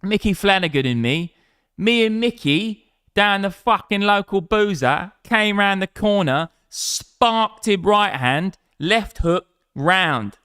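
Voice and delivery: loud, high Cockney geezer bravado; mock Cockney. Streamer boasting about a pub scrap, hammed-up Cockney accent. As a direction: build the Cockney punch-up yarn with relish. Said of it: "You sound like a proper".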